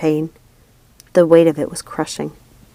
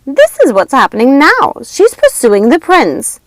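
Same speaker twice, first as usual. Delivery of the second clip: eager witch; 8 semitones higher, 9 dB louder than usual. A narrator's reading. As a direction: relish the news. Lucinda tells sisters.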